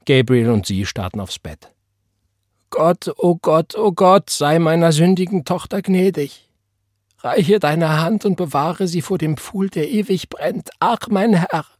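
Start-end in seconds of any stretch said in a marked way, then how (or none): 2.7-11.7 high-pitched, wailing plea to God; crying out in prayer. A narrator's reading. Mother begs God to spare her sinful daughter, crying out. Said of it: "rief ihre Mutter".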